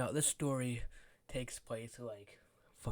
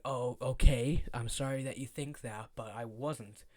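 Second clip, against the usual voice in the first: sarcastic; 9 dB louder, slower than usual. Retold or imitated. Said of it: "I was like".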